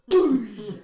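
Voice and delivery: explosive high, loud boosh; imitated impact crash. Game master voices the minecart falling, loud crash noise.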